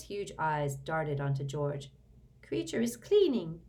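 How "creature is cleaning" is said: a touch evasive, high elf voice; evasive house-elf. A narrator's reading. House-elf evades George's question, lightly evasive. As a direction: dodge the question, lightly elfin. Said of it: "he said evasively".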